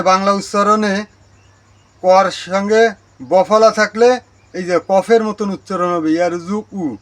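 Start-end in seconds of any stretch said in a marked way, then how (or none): none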